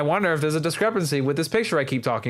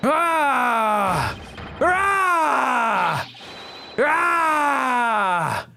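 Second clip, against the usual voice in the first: anguished cries; 8 semitones higher, much slower than usual. Acted. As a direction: cry out in anguish. Astronaut's breakdown cries read aloud.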